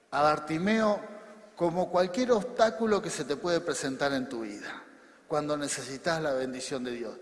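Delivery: ordinary talk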